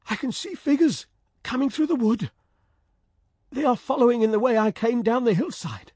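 high, excited call; joyful cry. Acted. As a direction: call out with sudden joy. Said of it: "Ulrich gave a joyful cry".

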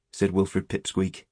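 ordinary delivery

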